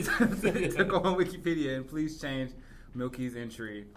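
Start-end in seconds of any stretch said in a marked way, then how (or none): 0.0-1.8 laughing. Real, not acted. Mock appeal to listeners, laughing.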